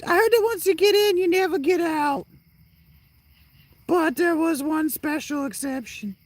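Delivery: very high falsetto figure; character impression. Game character describing the desert prison, high squeaky voice. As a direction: hold a high, squeaky cartoon voice. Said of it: "That's how he talks".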